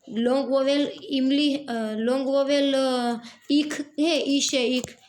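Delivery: normal talking voice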